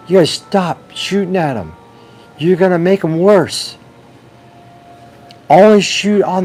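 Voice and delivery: desperate doctors screaming stop; frantic shouting. Acted. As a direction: yell at them to stop shooting.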